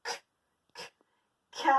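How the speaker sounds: "k" as a bare click of the consonant, no vowel; just the consonant "k", released sharply; isolated k sound. Shown as a demonstration. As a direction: pop a lone "k" twice with a gap. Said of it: "Où est-ce qu'on entend le son".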